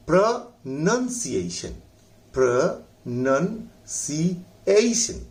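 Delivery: प्रोनंसिएशन very slow, syllable by syllable; slow model pronunciation. Shown as a demonstration. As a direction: stretch प्रोनंसिएशन out for students. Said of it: "इसमें word देखिए". (धीमा शब्द उच्चारण)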